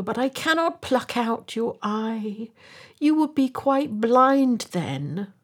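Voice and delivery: high-pitched storybook protest; pleading high bird voice; dismayed swallow. A narrator's reading. Swallow protests at blinding the prince.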